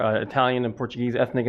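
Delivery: usual speaking voice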